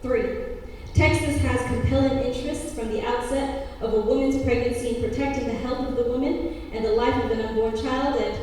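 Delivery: loud, stiff bill recitation; formal legal reading. Acted. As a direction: read it like a statute. Reads bill text in poem.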